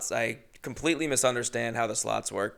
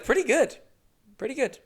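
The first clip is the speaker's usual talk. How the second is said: much higher, squeaky verdict; mock high voice; 13 semitones higher than usual. Comic rating the Mexican place, silly high voice.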